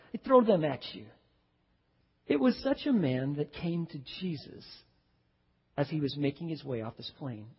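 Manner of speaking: ordinary delivery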